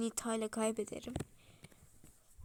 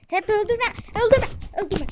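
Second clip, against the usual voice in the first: screamed high and loud; desperate gamer shriek; furious scream; 10 semitones higher, 16 dB louder than usual. Real, not acted. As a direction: scream at the enemy not to kill you. Screaming at enemy.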